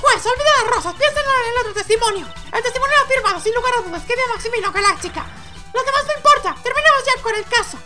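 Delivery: very high, squeaky, pressing voice; impatient objector. Acted. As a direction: urge the judge on in a high character voice. Personaje presiona al juez. (impaciencia insistente con voz muy aguda)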